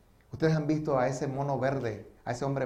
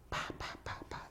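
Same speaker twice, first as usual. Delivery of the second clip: soft, voiceless popping shots; imitated gunshots; 15 dB quieter than usual. Former soldier showing how he killed, imitated shots. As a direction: mimic gunfire quietly with the mouth.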